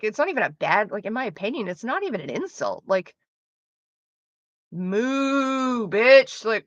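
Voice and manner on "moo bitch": mock taunt. Mimicking the bully's cow insult as a sneering taunt.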